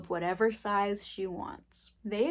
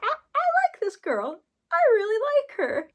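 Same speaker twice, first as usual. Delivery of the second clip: high, loud fangirl outburst; excited gushing; 13 semitones higher, 7 dB louder than usual. Retold or imitated. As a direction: gush it loudly, pitched way up. Gushing over a favorite character.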